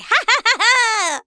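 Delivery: high evil cackle; witch cackle. Acted. Witch gloating over her plan, high evil cackle. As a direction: cackle with glee.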